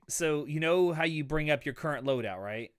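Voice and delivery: usual speaking voice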